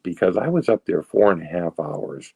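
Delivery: ordinary talk, nothing marked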